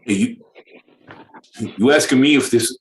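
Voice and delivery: hammed-up New Yorker accent. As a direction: challenge them in a mock New York accent. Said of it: "in a New Yorker accent".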